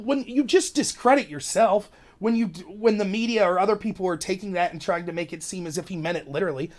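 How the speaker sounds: ordinary talk, nothing marked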